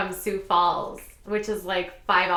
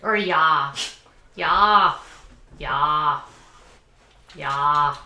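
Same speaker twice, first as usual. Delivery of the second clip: Midwestern yah; 5 semitones lower, 4 dB louder, much slower than usual. Shown as a demonstration. Demonstrating regional "yah.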